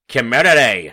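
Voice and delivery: loud, clipped Northern Irish "Community"; Northern Irish accent. Pretend. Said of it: "a silhouette of someone going".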